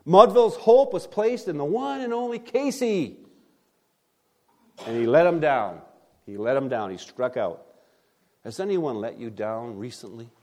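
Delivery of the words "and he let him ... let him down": normal talking voice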